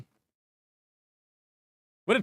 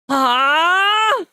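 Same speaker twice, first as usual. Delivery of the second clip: high, loud battle shout; battle cry; 10 semitones higher, 6 dB louder than usual. Acted. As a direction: scream as the attack unleashes. Heroine unleashing attack.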